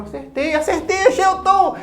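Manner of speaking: suddenly thrilled it worked; excited surprise. Retold or imitated. Alegria de acertar o acorde. (empolgado, bem mais agudo, surpreso)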